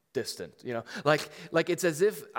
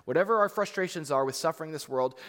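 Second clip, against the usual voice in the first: raised, high-pitched, insistent preaching; impassioned preaching; 5 semitones higher, 8 dB louder than usual. Real, not acted. Pastor assuring the church God shares their hurt, impassioned preaching. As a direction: preach it out loud with rising conviction. Drives home the sermon's point.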